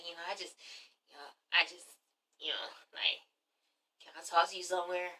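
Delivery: faltering whisper, low; hesitant, breathy ask; whispered plea. Retold or imitated. Matt whispering to Kenya, asking to talk alone. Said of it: "He's like".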